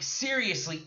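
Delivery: usual speaking voice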